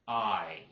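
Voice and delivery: "I" dragged out long and loud; stretched, unblended "I", said emphatically; drawn-out Southern vowel. Shown as a demonstration. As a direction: drag out "I" the Southern way. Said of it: "We'll drag it out".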